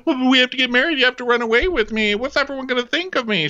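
high, loud whiny pleading; hammed-up clingy lover in a fluster; mock panicky suitor. Imitating the needy panicking suitor.

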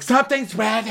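very high wailed alarm; high, panicky imitation; mock alarm. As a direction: cry it out in hammed-up dread. Mimicking Lisa's alarm.

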